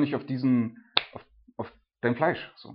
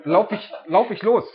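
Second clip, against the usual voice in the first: excited start; 7 semitones higher, 7 dB louder than usual. Retold or imitated. Acting out marathon start.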